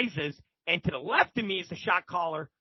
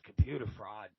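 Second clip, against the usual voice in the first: low, very soft repeat; muted, low disbelief; unimpressed echo; 10 semitones lower, 10 dB quieter than usual. Retold or imitated. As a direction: imitate an unimpressed inmate echoing the answer. Shot caller echoes answer, unimpressed.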